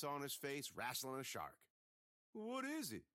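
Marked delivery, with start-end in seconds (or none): 2.3-3.0 put-on high, puzzled boy's question; a lightly voiced dumb kid; Jimmy asking. A narrator's reading. Jimmy asking the others, a lightly voiced puzzled question. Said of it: "dumb Jimmy asked".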